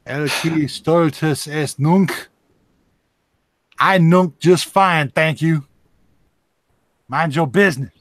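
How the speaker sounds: slow, loud pompous preaching; mock Latin sermon. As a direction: boom it like a priest.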